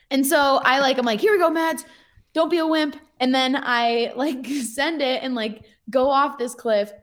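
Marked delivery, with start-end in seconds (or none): 1.2-3.0 high-pitched retold yell at yourself; self pep yell. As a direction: psych yourself up before the drop. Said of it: "I definitely yell at myself a little bit".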